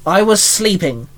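ordinary delivery, nothing marked

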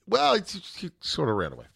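hesitant, play-acted breaking of bad news; mock sheepish confession. Dad telling daughter the dog is gone, mock sheepish. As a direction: start high, then drop away.

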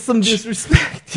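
high, slow, laughing through it; amused, drawn-out echo. Real, not acted. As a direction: repeat the words while cracking up.

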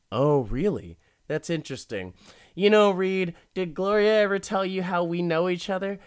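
lightly voiced smug creep; creepy rich guy. Recapping the show, imitating the rich stalker's smug voice. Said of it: "He's like".

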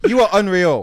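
laughing, loud and high; laughing disbelief. Real, not acted.

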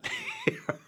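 a sudden high nervous laugh; real laugh breaking through a grim memory; high burst of laughter. Real, not acted. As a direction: laugh out at the darkness of it. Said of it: "I'm laughing now".

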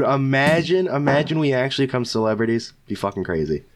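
normal talking voice

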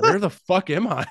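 loud, high, drawn-out disbelief; hammed-up baffled exclamation; mock bewilderment. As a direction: act out being totally thrown, slowly. Acts out his bewildered reaction.

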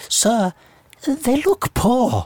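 put-on high, slow posh child voice; drawn-out posh kid's accent; posh schoolchild. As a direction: mimic a very posh little pupil. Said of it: "were very posh".

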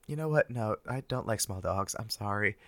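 usual speaking voice

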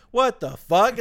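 loud, high mock outrage; play-acted angry outburst; pissed off. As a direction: imitate someone indignantly fed up. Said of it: "he's, like, pissed off".

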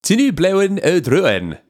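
high, slow, sing-song mock Welsh; exaggerated Welsh lilt, drawn out. Shown as a demonstration. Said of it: "do it more Welsh".

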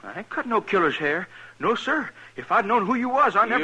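a scared barber backing away from the chair; frightened protest. Acted. Barber learns client is killer.

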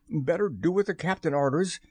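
understated nervous pirate. A narrator's reading. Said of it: "said Starkey nervously".

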